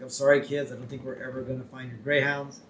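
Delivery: ordinary delivery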